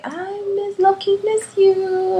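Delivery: high, slow, tender plea; tender missing you. Retold or imitated. Telling her sick friend she misses her, slow and tender. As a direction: stretch it out lovingly. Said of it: "I told her, I was like".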